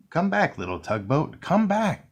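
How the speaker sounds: calling after the boat, rising high on the second come back; child's cry. A narrator's reading. Little boy calls after his tugboat, rising high. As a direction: call out after the drifting boat. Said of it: "cried the little boy".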